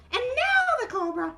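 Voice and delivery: high puppet voice, counting off; Mimi. Acted. Mimi recounts the guests, high puppet voice. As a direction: recite as a tiny character.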